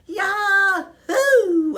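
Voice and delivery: very high, loud hamster cheer; delighted squeak. A narrator's reading. Hamster cheering Aldo's engagement, delighted high squeak. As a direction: shriek it with pure joy like a tiny hamster. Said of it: "I squeaked with delight".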